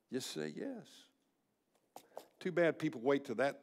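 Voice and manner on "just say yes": very soft, almost breathless last plea; fading whisper. Retold or imitated. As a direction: let the plea fade nearly to nothing. Pastor at dying person's bedside.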